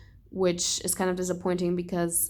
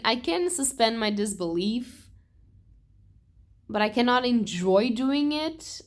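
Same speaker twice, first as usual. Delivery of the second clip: usual speaking voice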